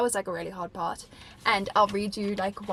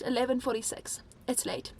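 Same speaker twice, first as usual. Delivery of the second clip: ordinary talk, nothing marked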